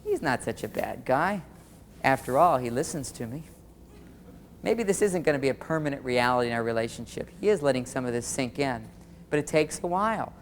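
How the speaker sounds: low, soft musing to herself; thinking it over. Acted. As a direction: relent gradually in a quiet inner voice. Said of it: "then she starts thinking".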